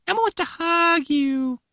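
slow, very high puppet voice; drawn-out falsetto offer of affection; Elmo. Acted. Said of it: "Stop with the voice".

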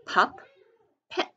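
"pup, pet" as two separate beats; teacher breaking "pup, pet" apart for spelling; syllable by syllable. Shown as a demonstration. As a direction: sound out "pup, pet" with a long gap. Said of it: "sound it out by syllables".

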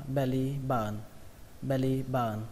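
"belly button" with button as "ba-ờn"; dropped T. Shown as a demonstration. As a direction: leave the t out of "button". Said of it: "bỏ luôn âm T ở đây". (đọc "belly button" bỏ âm t)

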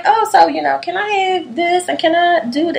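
high, innocent voice slipping in a request; sneaky sweet ask. Retold or imitated. A friend sweet-talking her into signing papers, innocent high voice. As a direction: coax it sweetly, like it's nothing. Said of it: "she would just low-key be like".

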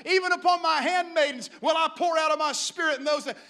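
loud, high, full-force preaching; preaching like fire. Acted. Peter stands up to preach in the streets, fiery shouted preaching. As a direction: proclaim the prophecy in a sustained shout. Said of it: "he's preaching like fire".

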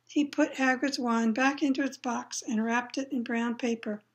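usual speaking voice

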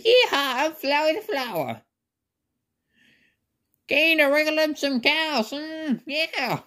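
high-pitched cartoon cowboy; cowboy Flowey. Flower playing cowboy after cows, high cowboy voice. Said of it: "I feel like I sound like a cowboy".

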